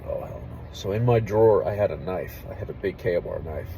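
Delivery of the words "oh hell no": softer, hushed mock horror; hushed dread. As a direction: mutter it low in disbelief. Retold scared reaction.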